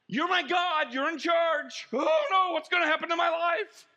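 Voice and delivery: high, loud mock wail; hammed-up despair, close to shouting; panicked worrier. Worrier crying out to God, hammed-up panic. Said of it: "what we do with God when we worry".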